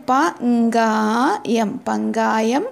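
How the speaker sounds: പങ്കായം stretched out very slowly, syllable by syllable; teacher spelling out പങ്കായം at a crawl; very slow model word. Shown as a demonstration. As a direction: draw out പങ്കായം slowly so the class can copy it. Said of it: "അപ്പം നോക്കൂ".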